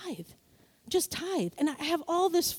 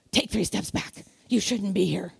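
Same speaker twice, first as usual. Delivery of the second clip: much lower, sneering command; mock devil voice; 8 semitones lower than usual. Devil ordering the believer back, much lower. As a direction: push them back with a low growl.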